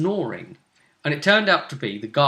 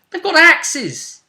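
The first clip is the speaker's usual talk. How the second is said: high, loud exasperated objection; incredulous protest; 10 semitones higher, 6 dB louder, slower than usual. Real, not acted. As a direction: point out the obvious, voice leaping up. Scoffing at the character's hopeless expectation.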